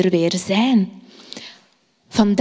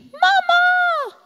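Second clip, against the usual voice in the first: put-on a small child calling out much higher; child calling mama. Scared little Salomo calling his mother at night, high calling voice. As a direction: call for mama like a scared little boy in the dark. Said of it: "roept hij".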